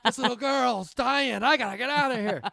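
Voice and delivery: high, loud, laughing panic, slowing down; overwhelmed and desperate to flee; mock panic. Tripping hiker panics to escape the hurt girl, high and loud.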